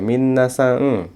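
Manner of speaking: slow teaching demo of "minasang"; final N as eng. Shown as a demonstration. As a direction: stretch "minasang" slowly to show the ng. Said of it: "dibacanya eng".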